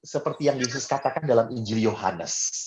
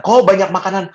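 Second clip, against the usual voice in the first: surprised priest; 6 semitones higher, 6 dB louder than usual. Retold or imitated. Fellow priests see the food.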